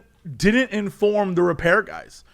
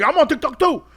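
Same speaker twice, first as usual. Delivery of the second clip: eager bro; 9 semitones higher than usual. Retold or imitated. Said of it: "the guy's like".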